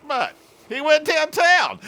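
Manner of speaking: high, loud, drawn-out gleeful yell; retold punchline outburst. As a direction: blurt it loud and high, stretching it out. Punchline restarts the laughing.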